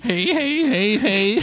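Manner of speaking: high, put-on "hey hey" laughing; exaggerated high chuckle; mock Southerner's laugh. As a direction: imitate a Southern cackle in words. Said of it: "That's how real Southerners laugh".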